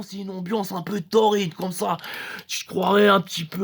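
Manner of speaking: usual speaking voice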